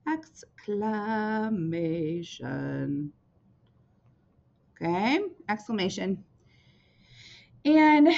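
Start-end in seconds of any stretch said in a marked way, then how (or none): 0.1-3.1 drawn out. Shown as a demonstration.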